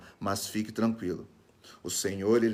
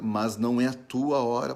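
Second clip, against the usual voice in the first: ordinary talk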